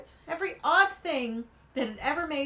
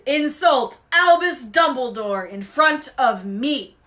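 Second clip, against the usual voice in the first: loud, slow, furious warning; booming, drawn-out threat; thundered; 9 dB louder, slower than usual. A narrator's reading. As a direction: hammer out every word in rage. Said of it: "he thundered".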